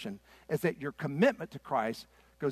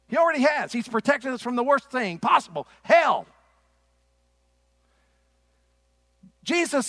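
loud, high-pitched pulpit challenge; preaching; 7 semitones higher, 7 dB louder than usual. Real, not acted. Preacher challenges his congregation over church dropouts, preaching loudly.

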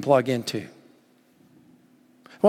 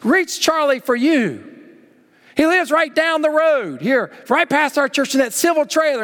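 higher and louder, pleading prayer; fervent pleading elder; 11 semitones higher, 3 dB louder than usual. Retold or imitated. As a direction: beg God to step in for Charlie. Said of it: "he'd go on and on".

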